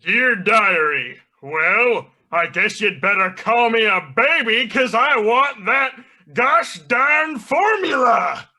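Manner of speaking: slow drawn-out opening, rising to a high scheming rant; Plankton. Acted. Villain confides in his diary about the formula, ranting cartoon voice. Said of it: "we are reading out the diary of Plankton".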